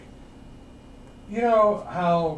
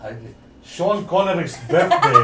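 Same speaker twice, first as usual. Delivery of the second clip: Scottish accent; 5 semitones higher, 6 dB louder than usual. Shown as a demonstration. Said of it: "Scottish line".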